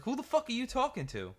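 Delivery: high-pitched, indignant snap; mock outraged drive-thru lady. Drive-thru worker snaps back at my friend, high and outraged. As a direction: squeal it like an offended worker. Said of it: "And she's like".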